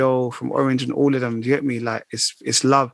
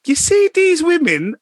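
very high, drawn-out mock mum voice; imitated mum; 14 semitones higher, 4 dB louder, slower than usual. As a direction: send your voice right up and stretch it like mum. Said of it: "She's like".

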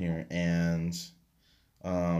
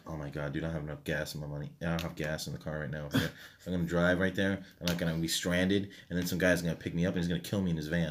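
low, quick, anxious rambling; worried inner monologue; 5 semitones lower, 5 dB quieter, faster than usual. Retold or imitated. Man acting out his worries over gas, anxious rambling. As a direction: spiral through worries in a fast, low murmur. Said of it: "I worry about every little thing".

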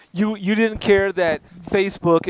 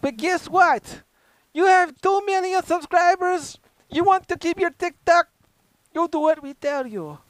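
bad Chinese accent; 7 semitones higher than usual. Host mocking China's TikTok owners, in bad Chinese accent. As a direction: put on a bad Chinese accent, pitched high. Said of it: "It's a really bad Chinese accent".

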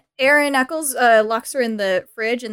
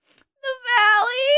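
much higher, slow, fake-tearful whine; mock sobbing Cher; 10 semitones higher, much slower than usual. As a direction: draw it out like a faked sob. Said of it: "She's putting on a hysterical".

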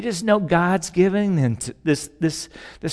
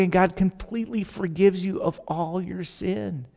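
ordinary talk, nothing marked

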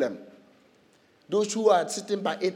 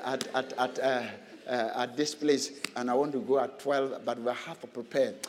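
mumbled excuses; 8 semitones lower, 10 dB quieter than usual. Retold or imitated. Preacher imitates a husband dodging church, mumbling. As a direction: lower and much softer, fumbling excuses. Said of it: "mimicking an excuse-making husband".

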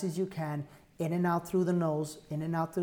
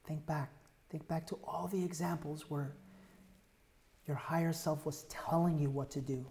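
soft guided meditation; 4 dB quieter than usual. Acted. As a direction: draw them inward softly.

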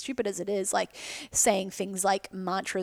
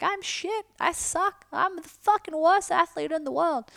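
much higher, hammed-up self-disgust; much higher voice of the self-blaming athlete; mock self-loathing; 10 semitones higher, slower than usual. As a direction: wail the inner put-down at yourself. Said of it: "be like".

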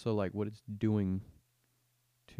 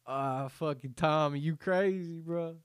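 zoned-out bro; 6 semitones higher than usual. Pretend.

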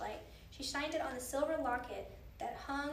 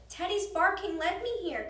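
higher, louder excited call; crying out; 6 semitones higher, 6 dB louder than usual. A narrator's reading. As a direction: tell him eagerly over the noise. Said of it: "cried Annie".

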